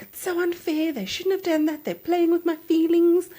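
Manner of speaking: high, wounded mock complaint; hammed-up indignation; aggrieved protest. Retells husband's upset complaint.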